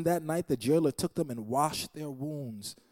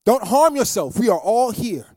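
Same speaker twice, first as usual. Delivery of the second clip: loud, high urgent call; shouted plea across the prison; Paul shouting; 9 semitones higher, 11 dB louder than usual. Retold or imitated. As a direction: cry out to stop him. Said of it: "But Paul shouted".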